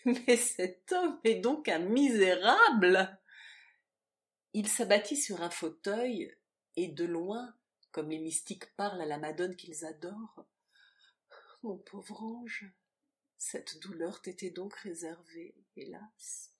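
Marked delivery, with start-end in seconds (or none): 0.1-3.2 loud, slow, emphatic indignation; indignant outburst. A narrator's reading. Man learning who told her everything, indignant outburst. Lover outraged at the betrayal. 11.6-16.5 sorrowful pity. A narrator's reading. Man grieving for his poor angel, sorrowful and soft.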